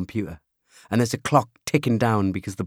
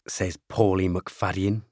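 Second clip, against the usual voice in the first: plain reading voice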